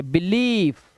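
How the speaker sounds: "belief" with a drawn-out ee; slow teacher's model of "belief", long ee; long E vowel. Shown as a demonstration. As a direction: stretch the second syllable of "belief". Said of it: "long vowel sound ई".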